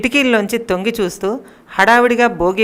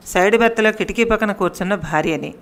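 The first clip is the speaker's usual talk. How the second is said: plain reading voice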